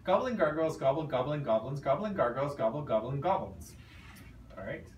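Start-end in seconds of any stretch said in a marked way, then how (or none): 0.0-3.7 fast timed tongue twister. Shown as a demonstration. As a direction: rattle it off quickly. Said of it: "and then I'll do it".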